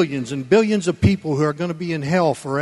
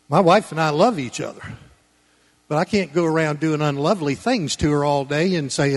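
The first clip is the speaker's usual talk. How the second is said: usual speaking voice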